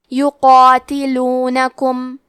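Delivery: یقاتلونکم very slow, the قا and its long alif heavy and full. Shown as a demonstration. Teacher showing students the heavy madd, slow. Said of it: "مد الف کو بھی بھاری آواز میں ادا کرنا ہے".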